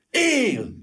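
high, drawn-out joyful exclamation; thrilled outburst at sudden hope; electrified cry. A narrator's reading. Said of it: "kiáltott fel Gyuri felvillanyozva".

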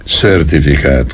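usual speaking voice

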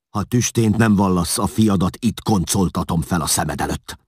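loud, high, angry ultimatum; furious threat. A narrator's reading. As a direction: threaten him with full force. Ruler threatens to kill son.

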